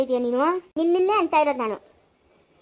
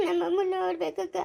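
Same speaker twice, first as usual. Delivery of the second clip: sobbing plea, softer; crying; 3 semitones higher, 3 dB quieter than usual. Acted. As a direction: cry out for mother through sobs. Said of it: "ಯಾಕ್ ಅಳ್ತಾ ಇರೋದು". (ಬಿಕ್ಕಳಿಸಿ ಅಳುತ್ತಾ)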